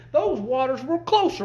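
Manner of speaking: ordinary delivery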